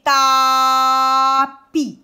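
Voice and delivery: TAPI with a loud, long TAA, short PI; syllable by syllable. Shown as a demonstration. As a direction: stretch the A in TAPI. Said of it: "Première ou deuxième syllabe?".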